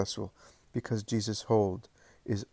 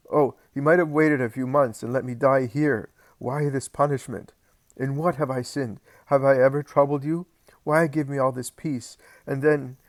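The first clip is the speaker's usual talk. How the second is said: higher and louder, desperate questions; anguished outburst; 5 semitones higher, 6 dB louder than usual. Acted. John complaining to Mary, loud and higher. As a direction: pour out the hurt.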